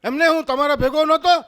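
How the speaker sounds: high, loud mock pleading; hammed-up urgent question; husband pressing friend. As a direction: push for the alibi, loudly.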